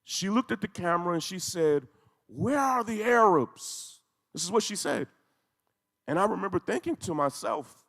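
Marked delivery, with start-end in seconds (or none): 2.2-4.0 higher, slow grieving cry; anguished plea. Retold or imitated. Grandmother on live feed calls out, slow and anguished. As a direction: plead slowly, voice lifted in grief. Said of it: "And she's screaming".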